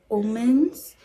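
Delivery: OMENS said in an oddly drawn-out way; odd pronunciation. Real, not acted. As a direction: say OMENS slightly weird. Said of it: "I said omens so weird".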